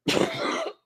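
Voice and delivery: short unvoiced cough; dry cough. Real, not acted. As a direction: cough mid-sentence. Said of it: "as I say that, I start to cough".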